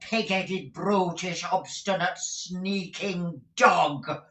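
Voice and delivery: high, furious screeching insults; shrill scolding wife. Acted. Missus Squeers beating Smike, screeching furious insults. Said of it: "exclaimed Missus Squeers".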